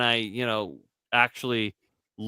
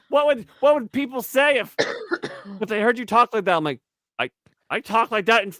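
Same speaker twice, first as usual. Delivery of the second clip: imitated scolding wife; 6 semitones higher, faster than usual.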